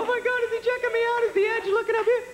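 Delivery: very high, rapid mock panic; freaking out. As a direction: squeal it in a hammed-up fluster. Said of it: "I'm like freaking out".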